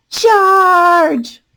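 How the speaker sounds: loud, high charging yell; battle cry. A narrator's reading. Leopold charging the balloon, loud battle cry. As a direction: roar it as you attack.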